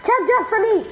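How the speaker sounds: high excited gamer cry; excited call. Real, not acted. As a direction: call out eagerly. Excited game shout.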